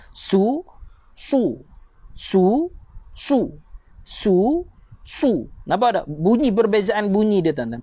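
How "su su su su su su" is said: "su" with the tongue raised, a heavy emphatic s; thick su. Shown as a demonstration. Teacher drilling sin and sad sounds. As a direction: alternate light and heavy "su" to show the difference. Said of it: "Lidah terangkat sikit".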